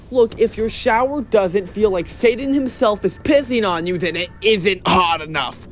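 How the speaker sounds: ordinary talk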